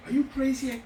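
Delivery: high-pitched retold protest; incredulous. Owner balking at begging the chef back, incredulous. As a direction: recoil at a mad suggestion.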